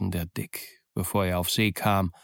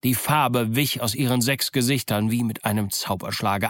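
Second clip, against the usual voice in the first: ordinary delivery, nothing marked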